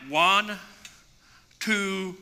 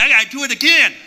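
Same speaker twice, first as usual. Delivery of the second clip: eager; 7 semitones higher, 8 dB louder, faster than usual. Retold or imitated. Eager retold decision.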